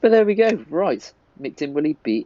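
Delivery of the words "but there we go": bright high burst; excited. Real, not acted. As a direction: pop up high on it.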